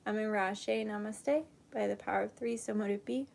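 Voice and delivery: soft, hushed meditation voice; guided meditation. Acted. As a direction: guide them gently into calm. Leads a meditation and prayer.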